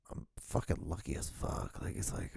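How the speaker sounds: very soft, breathy whisper; hushed whisper. Acted. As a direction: whisper it as if to yourself. Hushed aside of relief at his luck.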